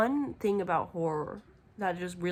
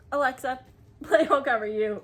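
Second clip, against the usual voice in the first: louder call with a laugh; calling to Alexa; 5 semitones higher, 7 dB louder than usual. Retold or imitated. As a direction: call out to the speaker across the room. Commanding Alexa.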